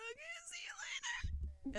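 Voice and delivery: very soft, high whispered goodbye; whispered squeal. Retold or imitated. Retold shy starstruck goodbye.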